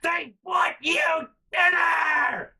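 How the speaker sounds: very high, loud, drawn-out mock scream; enraged mom. Mom with flames in her eyes, imitated screaming. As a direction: shriek it slowly as a furious mother. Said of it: "flames in her eye sockets".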